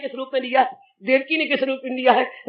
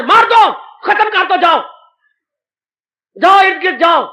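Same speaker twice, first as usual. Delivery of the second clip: raised, loud urgent orders; tyrant's command; 6 semitones higher, 5 dB louder than usual. Acted. Kans sending demons to kill newborns, loud commanding. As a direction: bark out orders to the henchmen.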